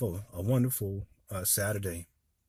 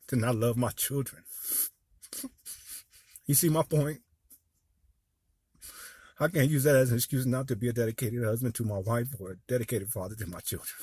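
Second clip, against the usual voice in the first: getting emotional. Real, not acted. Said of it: "I'm sorry for getting emotional".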